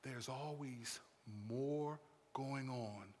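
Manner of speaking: very low, very soft, slow; hushed solemnity. Acted. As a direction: let it fall to a quiet, weighty murmur. Hushed closing point.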